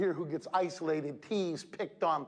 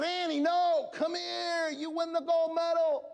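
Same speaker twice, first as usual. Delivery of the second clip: calling out; 11 semitones higher, slower than usual. Retold or imitated. Coach calling runner back.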